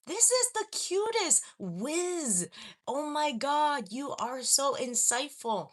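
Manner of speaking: high, loud, drawn-out gushing; gasp, then gush over how clever it is; gushing delight. Real, not acted. Streamer delighted by a viewer's clever wordplay, real gushing.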